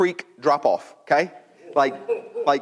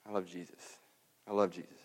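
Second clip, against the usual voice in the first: barely voiced, low repetition; hushed devotion; 8 semitones lower, 16 dB quieter, faster than usual. Shown as a demonstration. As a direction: repeat it softly like a private prayer. Urged testimony, repeated very softly.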